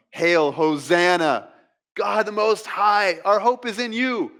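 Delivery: high, rising acclaim of a worshipping crowd; acted excited hailing. Preacher voices worshipers hailing Jesus, excited. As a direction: hail him loudly as an adoring crowd would. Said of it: "excited to worship him".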